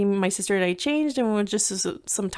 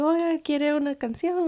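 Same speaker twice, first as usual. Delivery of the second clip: higher put-on voice for the mariachi's offer; imitating the mariachi; 7 semitones higher than usual. Mariachi offering mom a song, imitated in a higher voice. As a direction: lightly play the friendly mariachi.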